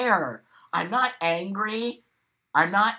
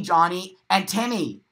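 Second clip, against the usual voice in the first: usual speaking voice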